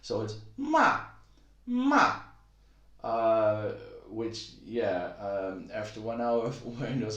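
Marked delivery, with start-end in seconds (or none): 0.6-2.2 "ma" launched very high and loud, then dropping; really high start, plunging "ma"; exaggerated fourth tone. Shown as a demonstration. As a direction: overdo the sharp fall on "ma". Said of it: "start high and you go down".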